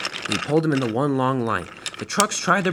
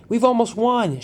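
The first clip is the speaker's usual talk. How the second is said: gleeful shout, higher and louder; 9 semitones higher, 4 dB louder than usual. Acted. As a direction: crow it out triumphantly. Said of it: "shouted one".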